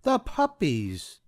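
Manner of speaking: haughty slow Cruella; Cruella de Vil. A narrator's reading. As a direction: savor the word like a greedy diva. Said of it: "Cruella said".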